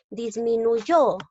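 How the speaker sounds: "Disminuyó" slowed, with YÓ held long; teacher's slow "Disminuyó", final syllable stressed; stress on last syllable. Shown as a demonstration. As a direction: hit and stretch the YÓ of "Disminuyó". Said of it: "fuerza de voz en la sílaba yo".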